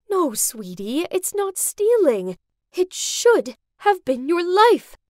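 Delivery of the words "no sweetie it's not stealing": ordinary delivery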